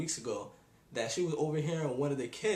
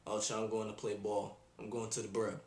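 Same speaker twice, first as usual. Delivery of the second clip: lower, softer mock slang talker; mock slang kid; 7 semitones lower, 5 dB quieter than usual. Mocking kids who add B to every word, lower softer parody. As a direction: parody the brazy crowd, lower and hushed. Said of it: "Like, it was cool".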